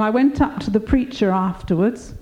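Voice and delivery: usual speaking voice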